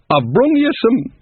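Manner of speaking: put-on high, slow homely old woman; cheery old witch. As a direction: offer the bowl like a chatty old neighbour. Nanny Ogg greets Granny.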